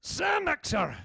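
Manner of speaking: put-on high Scottish shout, an old man straining; Scottish old man heaving himself off a couch; exaggerated Scottish accent. Shown as a demonstration. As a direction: groan it out loud in mock Scottish. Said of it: "the sound of an old man getting".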